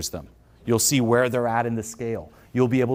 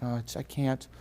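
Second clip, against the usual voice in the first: soft, self-pitying victim voice; mock grief; 4 semitones higher, 7 dB quieter than usual. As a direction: play the helpless little victim. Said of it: "in grief".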